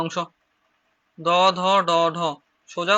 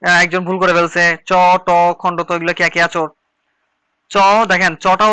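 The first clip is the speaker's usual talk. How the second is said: 1 raised correcting voice; 7 dB louder than usual. Real, not acted. Teacher calls out a student's mistake.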